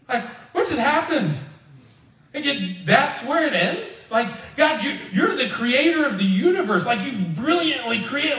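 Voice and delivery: exasperated, asking what just happened; baffled disbelief. Real, not acted. Preacher baffled by the book's ending.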